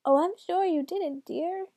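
high, slow, soothing granny; sing-song put-on granny; grandmother voice. A narrator's reading. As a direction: coo it high like a doting grandmother. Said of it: "in his best grandmother voice".

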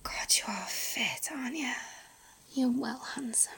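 soft intimate whisper; breathy close-mic whisper. Acted. Whispered intimate roleplay throughout.

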